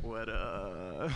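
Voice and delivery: low, creaky dude greeting; vocal fry greeting. Retold or imitated. Comic greeting the Uber driver in low vocal fry. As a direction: croak it out in heavy vocal fry. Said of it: "I got in there and I was like".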